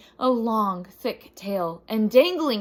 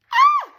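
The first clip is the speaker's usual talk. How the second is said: sudden piercing high scream; a sharp, startled high shriek; 14 dB louder than usual. A narrator's reading. Annie startled in the valley, piercing shriek. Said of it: "heard Annie shriek".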